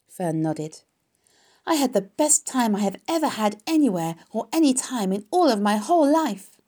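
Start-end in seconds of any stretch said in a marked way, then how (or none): 1.6-6.6 high, loud gushing; excited child. A narrator's reading. Fern tells her mother about the fair, gushing loudly. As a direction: gush with each phrase leaping up.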